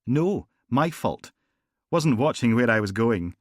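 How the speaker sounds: smooth polite Morningside gangster; Morningside accent. Acted. Gangster apologising to Logan in Morningside accent. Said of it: "his accent was pure Morningside".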